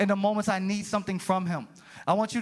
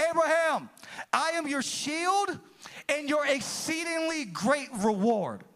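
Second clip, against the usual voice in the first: higher, slow, solemn proclamation; voice of God; 5 semitones higher, slower than usual. Acted. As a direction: announce it slowly like God calling Abraham. God speaking to Abraham.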